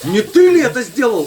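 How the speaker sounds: high, loud accusing question; bulldog's suspicious voice. Retold or imitated. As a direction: play the dog asking who did it. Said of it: "вскакивает и начинает внимательно всем смотреть в глаза".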